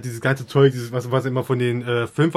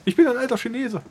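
mock voice; 10 semitones higher than usual. Jokey put-on voice line.

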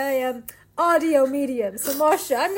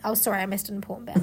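normal talking voice

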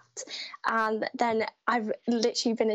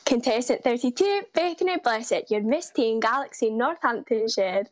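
[name] impression. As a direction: mimic a pageant announcer calling out a contestant. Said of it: "let me do Beth's one so you have".

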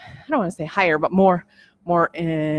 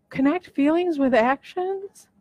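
higher and slow, marvelling at the phrase; amazed rhetorical question; 6 semitones higher, slower than usual. Real, not acted. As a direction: savour each word in wonder. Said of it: "That's powerful".

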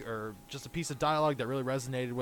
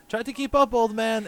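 higher, louder teasing; taunting quote; 7 semitones higher, 5 dB louder than usual. Retold or imitated. Podcaster quotes Pietro's taunt with a higher louder tease. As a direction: tease like a cocky speedster. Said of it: "he goes".

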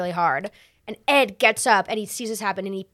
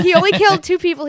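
higher, louder, fast fond insistence; Team Ed insistence; 8 semitones higher, 9 dB louder, faster than usual. Retold or imitated. Speaker raised on Team Ed, echoing mom's defence eagerly. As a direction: rattle it off like a devoted fan. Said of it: "I was born on Team Ed".

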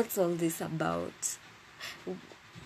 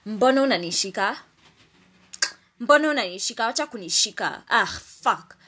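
loud, higher-pitched angry Swahili outburst; raised, mad voice; 4 semitones higher, 7 dB louder, faster than usual. Retold or imitated. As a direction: re-enact shouting someone off you, furious. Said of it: "my voice was raised. I was so mad".

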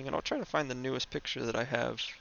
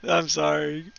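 louder, still laughing apology; 3 semitones higher, 8 dB louder than usual. Real, not acted. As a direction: gasp it out through laughter. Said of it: "[name]'s just laughing".

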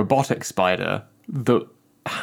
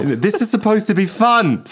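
exasperated parent; 9 semitones higher than usual. Pretend. Parent voiced in joke.

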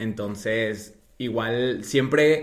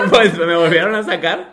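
laughing; 5 semitones higher, 8 dB louder than usual. Real, not acted. Laughs while answering.

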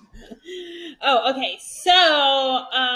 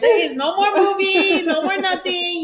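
firm mom; 7 semitones higher than usual. Retold or imitated. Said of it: "I'll be like".

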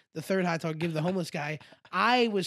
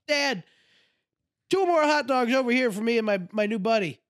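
put-on high, raised drunken ordering call; acting out a holler to dad; 4 semitones higher than usual. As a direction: cheerfully call an order across. Calls dad to order hot dogs.

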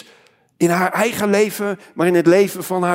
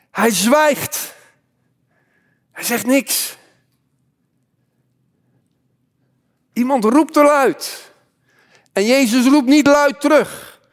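emphatic proclamation climbing higher, with weighty pauses; forceful preaching; 3 semitones higher, 3 dB louder than usual. Acted. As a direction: proclaim it loudly to the whole church. Preacher builds to Jesus' silence.

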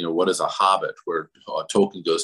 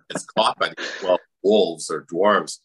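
ordinary delivery

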